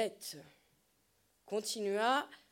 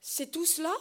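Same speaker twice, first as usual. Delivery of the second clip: exclamation étonnée; 4 semitones higher than usual. Acted. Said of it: "ne put s'empêcher de s'exclamer Jacques".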